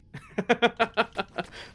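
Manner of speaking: high burst of laughter; loud amused laugh; laughing hard. Real, not acted. Big laugh opening.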